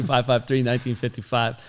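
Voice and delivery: ordinary talk, nothing marked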